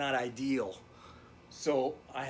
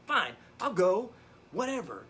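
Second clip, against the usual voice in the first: higher, louder indignant retort; huffy defiance; 6 semitones higher, 6 dB louder than usual. Retold or imitated. Husband kicked out by his ex-wife, retold huffy defiance. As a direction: throw it back like a sulky comeback.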